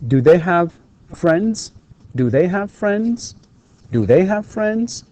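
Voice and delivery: "Do they have friends?" slowly, with a high rise on "friends"; rising intonation. Shown as a demonstration. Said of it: "Notice the rising intonation".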